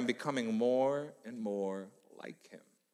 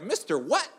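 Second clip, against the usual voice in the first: cheeky student; 7 semitones higher than usual. Retold or imitated. Student mocking sub's name.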